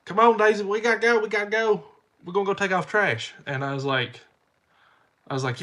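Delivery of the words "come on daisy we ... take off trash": put-on higher-pitched voice hurrying a child along; high mock hurry-up call; imitated wife. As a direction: imitate a wife calling the kid away. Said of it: "my wife's like".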